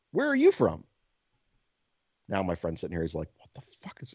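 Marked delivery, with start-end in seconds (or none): 0.1-0.8 put-on high, eager child asking; kid voice. Friend retelling the kid's bathroom questions, high childlike voice. 3.4-4.1 whispered disbelief; stunned whisper. Retold or imitated. Friend in the stall, whispering in disbelief.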